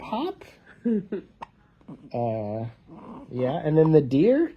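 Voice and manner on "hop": high springy hop; playful squeaky hop; bouncy hop. Pretend. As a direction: bounce the word up like a kangaroo. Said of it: "They go hop".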